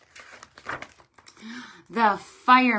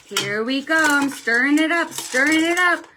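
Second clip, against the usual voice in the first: excited singsong; 5 semitones higher, 7 dB louder than usual. Acted. Stirring stew for kids.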